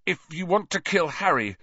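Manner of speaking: high, fierce defiance. A narrator's reading. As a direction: stand up to the killer fiercely. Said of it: "he said fiercely".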